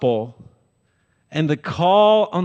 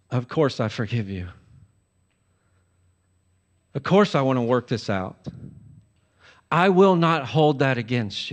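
gracious forgiveness; 4 semitones lower than usual. Retold or imitated. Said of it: "But if Jesus is supreme".

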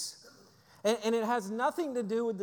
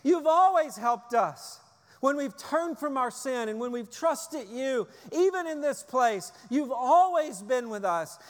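impassioned prayer; 4 semitones higher, 4 dB louder than usual. Pretend. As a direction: cry out in grateful prayer. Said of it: "we are declaring".

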